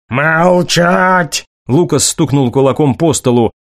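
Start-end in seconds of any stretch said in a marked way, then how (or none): 0.1-1.5 angry command. A narrator's reading. Father silences daughter, fist on table.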